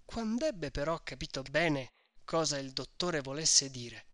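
usual speaking voice

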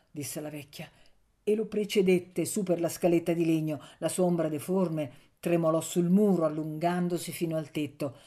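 ordinary delivery

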